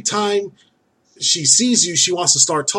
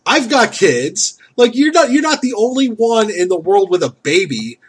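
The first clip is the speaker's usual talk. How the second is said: imagined rant, pitched up and fast in places; ranting retort; 6 semitones higher than usual. Retold or imitated. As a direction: tell her off, half laughing. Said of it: "and I would be like".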